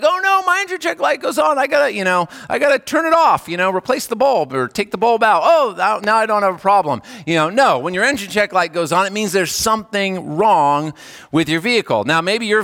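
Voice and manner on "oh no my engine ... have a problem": high, breezy sing-song brush-off; airy mock nonchalance; mock carefree driver. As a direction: toss off the warning light like it's nothing. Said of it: "you're not like".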